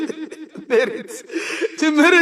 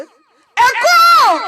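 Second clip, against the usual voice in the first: high, loud, long yell; shouting loudly; 9 semitones higher, 8 dB louder than usual. Shown as a demonstration. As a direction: shout to make the echo answer. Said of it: "il faut que je crie fort". (cri puissant et aigu)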